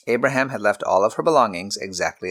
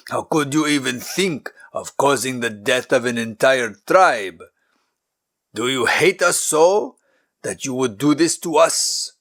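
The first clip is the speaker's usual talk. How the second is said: shouting back; 3 semitones higher than usual. A narrator's reading. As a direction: hurl the accusation back. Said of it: "the king shouted back".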